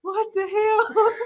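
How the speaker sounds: high, slow, laughing disbelief; stunned and giggling; amused shock. Real, not acted. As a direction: stretch it out high, cracking up.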